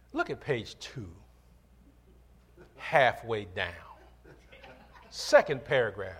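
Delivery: low, unhurried, with long pauses; calm tech support. Retold or imitated. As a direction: imitate a patient support agent, softly and evenly. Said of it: "He just calmly says".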